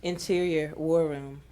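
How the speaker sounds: ordinary talk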